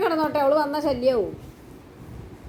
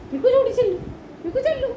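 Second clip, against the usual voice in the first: high-pitched urging; 12 semitones higher than usual. Real, not acted. Sending Mikku along, high-pitched coaxing. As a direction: urge the pet on, very high.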